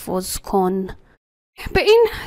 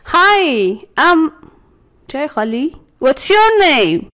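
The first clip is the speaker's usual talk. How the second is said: animated demo; 6 semitones higher, 4 dB louder, much slower than usual. Shown as a demonstration. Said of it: "سعی کن حالاتتو تغییر بدی".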